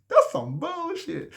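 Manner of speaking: high, slow, laughing through words. Real, not acted. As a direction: say it while still laughing. Laughing at the argument.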